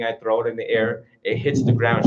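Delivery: normal talking voice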